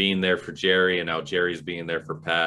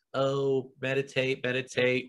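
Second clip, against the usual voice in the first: higher, monotone mock chant; pretend meditating; 6 semitones higher than usual. Kids pretending to meditate to hide their digging, mock chanting. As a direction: fake a meditation chant as cover. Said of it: "pretended to be meditating".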